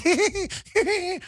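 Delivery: high-pitched mock giggle; exaggerated uneasy tittering laugh; Brian Stelter laugh. Host imitating anchor's nervous reaction, high forced giggle. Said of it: "That's Brian Stelter".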